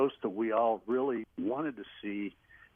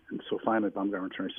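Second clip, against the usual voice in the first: ordinary talk, nothing marked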